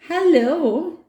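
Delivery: high, rising hello; high-pitched questioning greeting; hesitant child's reply. Acted. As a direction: answer a strange creature uncertainly. Nora answers the gruff stranger.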